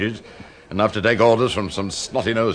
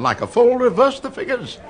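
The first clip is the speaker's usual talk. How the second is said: rising, scandalised account of the blunder; exasperated outburst; 13 semitones higher than usual. Acted. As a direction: pitch jumps way up in disbelief. Exasperated over colleague's blunder.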